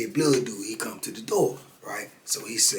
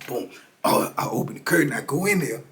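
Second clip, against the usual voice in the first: ordinary talk, nothing marked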